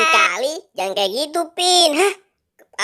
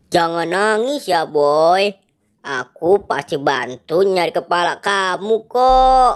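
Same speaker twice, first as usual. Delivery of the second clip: lower, comforting captain voice; reassuring hero; 6 semitones lower than usual. Acted. Captain promising crying Boy to help, reassuring. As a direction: soothe in a low heroic voice.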